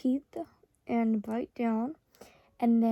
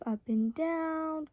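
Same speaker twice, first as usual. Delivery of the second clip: slow instructions; 5 semitones higher, much slower than usual. Acted. Instructing client slowly.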